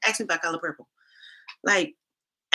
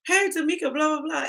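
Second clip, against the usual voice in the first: higher sing-song imitation of a friend; mock friend greeting; 9 semitones higher than usual. Imitating foreign friends greeting her, higher and breezy. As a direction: toss off a lightly voiced friend's hello.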